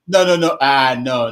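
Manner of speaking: loud repeated no to stop them; loud protest. Real, not acted. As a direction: shut the quarrel down. Host stopping the fight.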